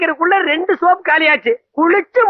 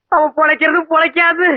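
ordinary talk

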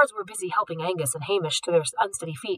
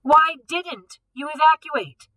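high, louder, slow angry snap; snapping; 9 semitones higher, 6 dB louder, slower than usual. A narrator's reading. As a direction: bite off each word at them. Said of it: "snapped at the teens".

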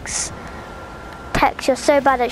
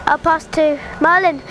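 ordinary delivery

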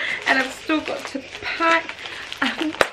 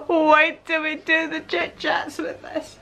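ordinary talk, nothing marked

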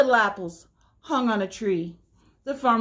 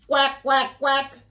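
duck quack; 4 semitones higher, 3 dB louder, slower than usual. Acted. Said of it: "called the duck".

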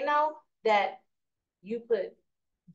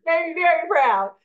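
normal talking voice

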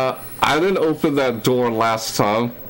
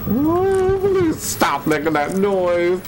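high, slow whimpered plea; frightened pleading; 7 semitones higher, slower than usual. Real, not acted. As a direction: beg slowly in a scared high voice. Frightened plea at game noise.

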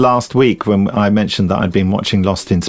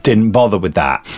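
normal talking voice

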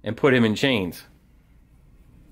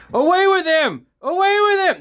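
much higher, louder mock crowd shout; angry crowd yelling; 4 dB louder than usual. Crowd shouting at Paul, a high mock yell. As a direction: yell it like a riled-up mob. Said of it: "continues to yell and scream".

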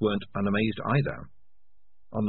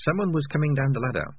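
ordinary delivery, nothing marked

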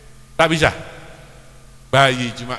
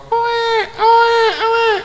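loud, very high mock baby cry; imitating a baby; 5 dB louder, much slower than usual. Shown as a demonstration. Preacher showing the men how to mimic a baby, loud high wailing. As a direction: wail "oe" loudly like an infant. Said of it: "montro niru bayi".

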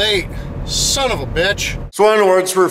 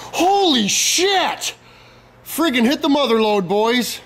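high, slow, drawn-out holy shit of amazement; stunned at the mother lode, stretching it high and slow; amazed shout; 8 semitones higher, slower than usual. Real, not acted. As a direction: let it rise way up, stretched in disbelief. Sees the pile of parts.

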